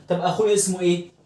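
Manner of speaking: normal talking voice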